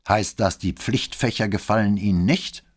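stern commander seeking a confession. A narrator's reading. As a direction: challenge the cadet strictly. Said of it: "fragte Bembo streng".

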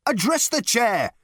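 high, drawn-out snapped order. A narrator's reading. Management consultant correcting Ford, snapped. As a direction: pull them up on procedure. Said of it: "snapped the management consultant".

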